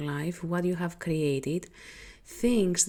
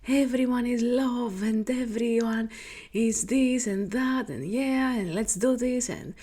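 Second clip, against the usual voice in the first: gushing, higher-pitched idealist; mock all-loving enthusiasm; 5 semitones higher, 3 dB louder than usual. Healer imitates her past all-loving self, gushing mock enthusiasm. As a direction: parody your own starry-eyed overgiving. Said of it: "I was in the space of".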